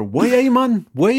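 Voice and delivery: high, loud mock Geordie "Way, man"; imitating a Geordie friend with a big lift; Geordie accent. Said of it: "I can't even do it in her accent either".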